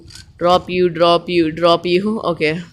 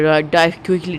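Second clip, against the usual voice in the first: normal talking voice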